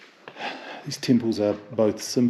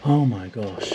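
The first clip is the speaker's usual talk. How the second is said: usual speaking voice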